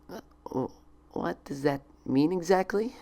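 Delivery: high, hesitant, stammering question; a frightened little stumble; nervous stammer. Acted. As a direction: falter out of nervousness. Twilight nervously swallowing.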